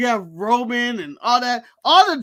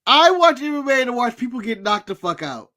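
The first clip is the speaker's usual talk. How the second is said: ordinary talk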